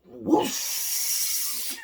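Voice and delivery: breathy imitated whoosh; voiced-out water sound; whooshing water noise. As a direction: make the rush of a waterfall. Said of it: "It said".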